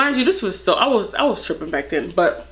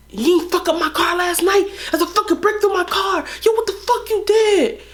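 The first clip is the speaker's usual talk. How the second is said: high, whiny mock boyfriend rant; mock angry boyfriend; 9 semitones higher than usual. Mocking her ex's outrage about the smashed car, high-pitched. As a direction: mimic him losing it over his car.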